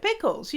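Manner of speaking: PICKLES pitched high, falling; emphatic repeat. Retold or imitated. Repeating it to mum who didn't understand, emphatic.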